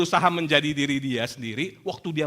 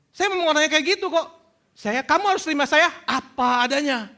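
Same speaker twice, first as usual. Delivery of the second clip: high, louder protesting voice; acted excuse-maker; 9 semitones higher, 4 dB louder than usual. Congregant defending their temper to the preacher, high insistent protest. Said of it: "pernah dengar kayak gitu?".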